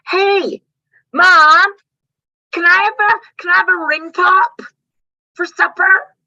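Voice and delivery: put-on high, loud child begging; toddler voice. Toddler loudly asking mom for a ring pop at dinner. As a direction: whine it like a three-year-old.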